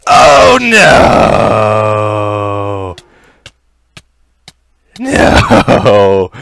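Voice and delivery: long, loud wailed "no"; anguished wail. Real, not acted. Gamer as things go wrong, anguished wail. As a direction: cry out in dismay, stretching it out. Loses it in game.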